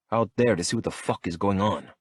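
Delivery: usual speaking voice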